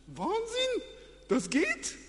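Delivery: high, far-off cry of wonder; amazed. Acted. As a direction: marvel at walking on water. Amazed at walking on water.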